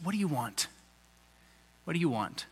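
low, soft, plain "What do you want?"; blunt and quiet. Shown as a demonstration. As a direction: ask it straight and quiet, as the real question. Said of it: "hear it like this".

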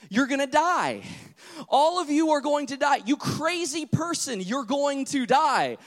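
high, loud frantic warning cry; children yelling. Retold or imitated. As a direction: yell it like scared kids shouting a warning. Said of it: "the children were yelling".

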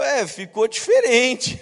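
imitando um elogio constrangido, agudo e arrastado; resposta sem jeito. Retold or imitated. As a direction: estique devagar, mais alto, tentando não ofender. (saída diplomática encenada, lenta e aguda)

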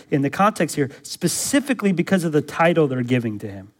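normal talking voice

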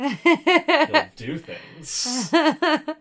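high, loud laughter in words; laughing. Real, not acted. Laughing at own joke.

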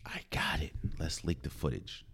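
whispered, hushed plotting; scheming whisper. Retold or imitated. Front office whispering plan to leak footage. Team scheming, voiced secretly.

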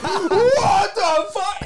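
very high, loud, drawn-out screech; mock outraged screaming; retold yelling. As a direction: imitate her yelling, stretched right out. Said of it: "They were just yelling".

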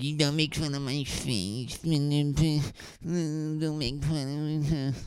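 high, drawn-out mock whine; high mocking voice of a hurt complainer; mock complaining letter-writer. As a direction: whinge it high and slow, mocking the offended. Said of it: "some people probably writing a letter".

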